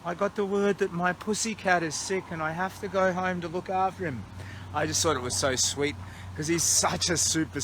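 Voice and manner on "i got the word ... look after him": imitating Einstein. Vlogger quoting his walking mate about the sick cat, mock higher voice. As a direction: gently parody his gentle way of talking. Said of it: "When he said".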